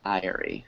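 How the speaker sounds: Diary said as DIE-uh-ree, three syllables. Shown as a demonstration. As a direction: open with a long I in Diary. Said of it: "one's two syllables and one is three".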